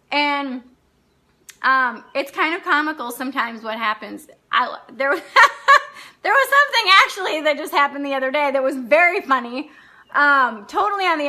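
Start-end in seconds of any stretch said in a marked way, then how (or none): 5.3-6.0 a burst of high laughter. Real, not acted. Laughing as she remembers the flirting waiter. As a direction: laugh out loud, pitched up.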